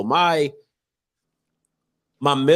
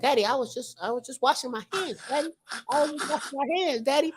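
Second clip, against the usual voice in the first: put-on higher, much softer child's voice; small, innocent kid excuse; imitating little son; 8 semitones higher, 10 dB quieter than usual. Imitating his son's excuse to daddy, high and soft. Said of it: "the way Shy talks".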